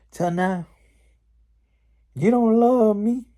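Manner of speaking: hammed-up indignant complaint, high and loud; upset East Witch. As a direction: accuse your sister like a wronged, whiny witch. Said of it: "the East Witch is all getting upset".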